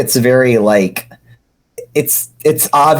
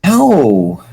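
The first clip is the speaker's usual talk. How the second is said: indignant; 5 semitones higher than usual. Acted. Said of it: "sounding indignant".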